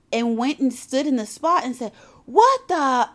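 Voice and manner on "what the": put-on high, loud childish blurt; loud copycat kid outburst. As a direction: blurt it out for all to hear. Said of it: "And he said it loud".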